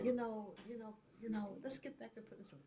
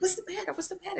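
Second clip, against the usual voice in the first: much higher, worried mother's call; alarmed mother; much faster than usual. Retold or imitated. Mother asking her crying daughter what happened, high and alarmed. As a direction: ask the child in sudden alarm.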